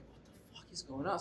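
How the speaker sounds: retold under-the-breath confusion; baffled muttering. Teller recalls wondering what is going on, baffled and hushed.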